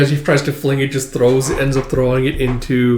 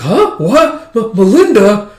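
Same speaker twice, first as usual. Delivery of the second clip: much higher, louder startled gasp; shocked recognition; 9 semitones higher, 7 dB louder, slower than usual. Retold or imitated. Woman recognizing her friend behind the mask, high startled. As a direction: stammer the name in sudden shock.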